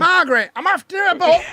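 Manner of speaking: high, loud calling voice; Little Britain character. Retold or imitated. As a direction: call out the name in a high put-on voice. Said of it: "Little Britain".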